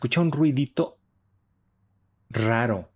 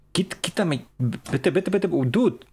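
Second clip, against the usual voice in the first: high, pleading go-away; shooing in fright; 7 semitones higher, slower than usual. Real, not acted. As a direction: push it away nervously. Ahuyenta al monstruo asustado. (ahuyentándolo con miedo)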